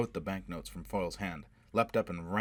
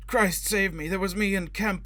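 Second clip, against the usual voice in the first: a much higher, louder scream of panic; desperate scream; 13 semitones higher, 8 dB louder than usual. A narrator's reading. Drowning man screaming the answer in terror. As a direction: scream it out to save yourself.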